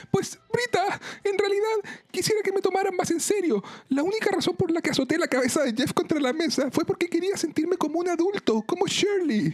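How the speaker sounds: put-on very high, overwrought girlish confession; emotional. A narrator's reading. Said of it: "Annie también emocionada dice".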